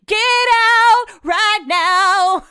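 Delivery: very high, stretched-out holler; half-sung hammed-up shout, dragging every word; exasperated yell. Wife sending husband off for the day, exasperated drawn-out yell.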